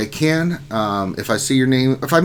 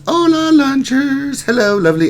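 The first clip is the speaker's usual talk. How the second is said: silly high voice; 14 semitones higher, 4 dB louder than usual. Pretend. Streamer reading a viewer's greeting in a squeaky voice. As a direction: squeak it out, drawn long.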